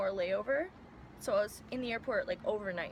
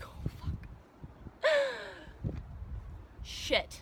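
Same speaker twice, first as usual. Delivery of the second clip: whispered panic; 11 semitones higher, much slower than usual. Retold or imitated. Panic on the plane.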